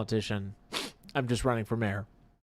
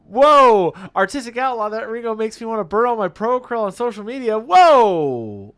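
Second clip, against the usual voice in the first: loud, high, hammed-up whoa; excited shouting; 9 semitones higher, 12 dB louder than usual. Host reads out a viewer's reaction, shouting excitedly. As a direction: belt it out with mock amazement. Excited reading of a chat message.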